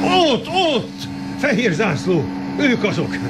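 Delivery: high, thrilled shout of discovery; excited spotting. Acted. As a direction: point and cry out in relief.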